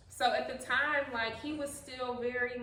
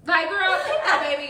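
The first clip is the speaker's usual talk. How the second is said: loud, raised-pitch comic cry; mock plea; 6 semitones higher, 11 dB louder than usual. Joking mom begging someone to take baby, mock plea. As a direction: call out in pretend exasperation.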